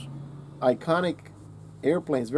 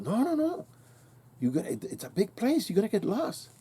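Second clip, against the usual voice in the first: freaked-out uncle; 7 semitones higher, 4 dB quieter than usual. Retold or imitated. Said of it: "He freaked out".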